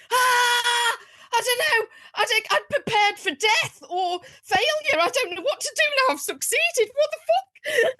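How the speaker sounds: high, frantic inner voice; panicking brain voice. Retold or imitated. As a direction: play her brain losing it over success. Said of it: "my brain just goes".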